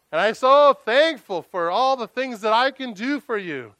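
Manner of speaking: a proud showman praying for an audience, booming; booming pompous Pharisee. Acted. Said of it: "it would have been booming if it was".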